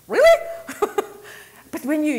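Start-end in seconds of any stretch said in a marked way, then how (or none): 0.1-1.1 much higher, loud laughing "Really?"; mock incredulity. Real, not acted. Mocking a horoscope, high loud laughing disbelief.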